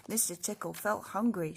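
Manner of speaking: ordinary delivery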